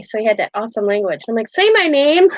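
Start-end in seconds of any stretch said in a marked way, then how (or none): 1.5-2.3 high, eager plea; excited request. Retold or imitated. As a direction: beg him playfully to say it. Asking UK man to say her name.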